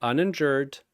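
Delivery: usual speaking voice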